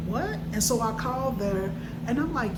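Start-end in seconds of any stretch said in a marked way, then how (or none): 0.1-0.4 sharp rising what, pitched high; shocked what. Retold or imitated. Hearing the hospital's voicemail, a stunned high question. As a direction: react to the voicemail in disbelief.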